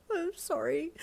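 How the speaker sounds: I'm sorry through tears, voice cracking up; tearful broken I'm sorry; crying apology. Acted. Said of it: "you wobbled in a broken voice".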